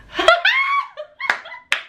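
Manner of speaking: squealed peal of laughter; high shrieking laugh. Real, not acted. Remembering dad telling the boy off, high laugh.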